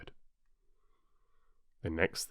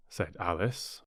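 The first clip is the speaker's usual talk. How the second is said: ordinary delivery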